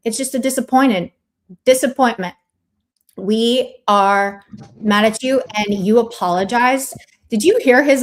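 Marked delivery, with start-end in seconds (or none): none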